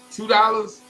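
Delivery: high-pitched, forceful disbelief; incredulous repeat. Retold or imitated. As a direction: push the amount out as an affronted question. Boy repeats the amount, more forcefully.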